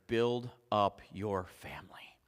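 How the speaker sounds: slow, breathy whispered exhortation; soft slow whisper. Acted. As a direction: whisper it like a secret blessing.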